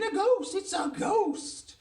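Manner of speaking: very high, loud cry of alarm; acted panic, last word drawn out; screaming. A narrator's reading. Townspeople spotting a walking dressing gown, screaming. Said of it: "people is screaming".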